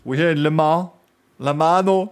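put-on Italian accent English. Rider joking at a press event, in mild Italian-accented English. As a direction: tease the rider's broken English with an Italian lilt. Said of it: "il voulait nous dire".

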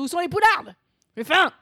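loud, high yell across a restaurant; boorish bellow. Acted. Heavy-set lout demanding food in the restaurant, boorish bellow. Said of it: "tu gueules dans le resto".

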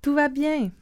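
a touch of a messenger's cry; mild calling out. A narrator's reading. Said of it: "Ahimaas cria au roi".

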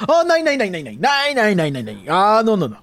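frantic nein nein, sped up; German accent. Acted. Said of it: "pas d'accent allemand problématique". (banquier allemand exaspéré, accent allemand)

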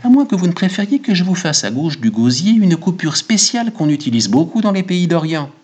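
high-pitched, obliging barber voice; eager barber. Acted. Barber swears to Goludja it will be quick, high eager voice. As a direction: pitch it up and press the offer.

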